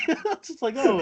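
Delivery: usual speaking voice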